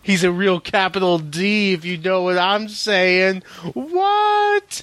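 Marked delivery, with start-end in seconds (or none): 3.7-4.8 squeaky high voice. Acted. High silly voice bit.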